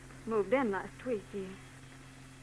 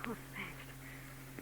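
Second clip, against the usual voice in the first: quiet; 12 dB quieter than usual. Acted. Sarcastic quiet thanks to detective.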